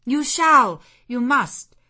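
emphatic command; commanding insistence. A narrator's reading. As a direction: refuse to let him go. Jane demands he tell.

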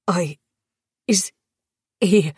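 fragmented question with long gaps; halting, pained. A narrator's reading. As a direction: struggle to get each word out. Tortured prisoner struggling to ask.

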